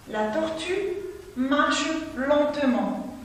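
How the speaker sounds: slow, careful dictation pace. Shown as a demonstration. Teacher dictating a sentence to pupils, slow. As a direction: read it slowly so the children can follow. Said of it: "Je répète".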